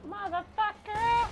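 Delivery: high, slow drawn-out curse; drawn-out yell. Real, not acted. Swearing in the fight.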